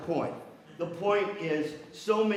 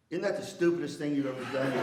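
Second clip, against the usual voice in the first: usual speaking voice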